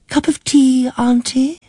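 a lightly voiced patient middle-aged niece. A narrator's reading. Niece reminds forgetful aunt who Christopher is, patiently. Jean offers tea.

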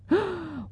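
sharp high gasp of temptation; a sudden breathless gasp. Acted. Narrator gasping at the cosmetics counter.

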